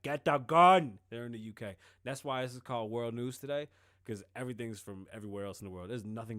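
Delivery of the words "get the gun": loud barked command; imitated urgent shout at the partner; urgent order. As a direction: shout the order to grab the gun. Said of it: "He's like".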